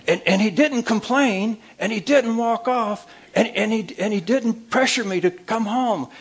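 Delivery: wife bragging to her mother, high and thrilled; excited wife on phone. Retold or imitated. Wife voiced again to sister.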